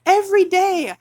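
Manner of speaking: real frustrated outburst; furious. Real, not acted. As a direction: hammer it home in rage. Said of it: "I'm furious".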